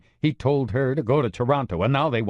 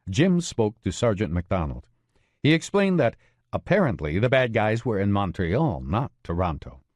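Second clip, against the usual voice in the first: usual speaking voice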